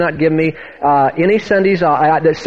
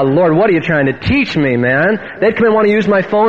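usual speaking voice

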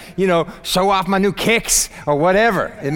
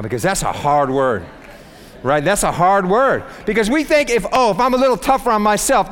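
ordinary delivery

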